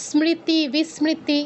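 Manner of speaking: স্মৃতি as smriti, m heard; sounded m. Shown as a demonstration. Teacher gives students examples, স্মৃতি with m sounded. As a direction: voice s plus m in স্মৃতি, বিস্মৃতি. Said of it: "এরকম".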